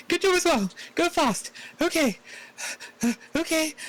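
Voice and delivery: very high, squeaky little cheer; excited character voice. Acted. Player's character returns a compliment mid-fight, very high voice. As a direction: pipe it up high, short bursts of encouragement. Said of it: "and just go".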